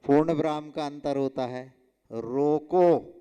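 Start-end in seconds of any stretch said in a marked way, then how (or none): 2.1-3.1 रोको drawn out loud, then a stop; pause after roko. Shown as a demonstration. Teacher showing where the comma falls, pause after roko. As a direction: stretch रोको and halt for the comma. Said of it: "अल्प विराम लगा दिया".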